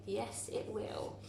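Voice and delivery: soft unvoiced whisper of relief; quiet relief. Real, not acted. Teacher whispering to herself as the card stays up. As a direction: breathe it out softly, pleased.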